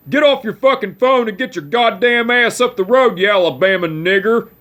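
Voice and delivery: angry yell. Retold or imitated. Great-grandpa shouting at the driver blocking the road, angry yell. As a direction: bark it like a cranky old man at a stalled driver. Grandpa yelling out car window.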